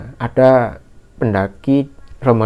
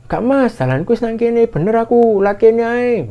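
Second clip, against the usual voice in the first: imitated calling back; 10 semitones higher than usual. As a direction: insist from far down the trail. Hiker insisting from afar. (menirukan sahutan ngeyel dari jauh)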